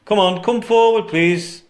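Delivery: loud call into the dark. Real, not acted. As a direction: call out to the spirit. Calling out to a spirit.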